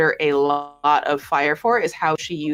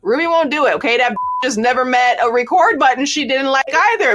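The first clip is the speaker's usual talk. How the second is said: ordinary talk, nothing marked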